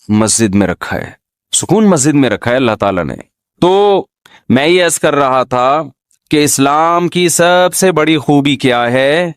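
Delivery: ordinary talk, nothing marked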